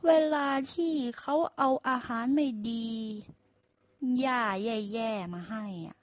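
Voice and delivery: boastful donkey teaching a trick; donkey lecturing the ox, pleased with himself; smug donkey. Acted. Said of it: "ด้วยความอดฉลาด".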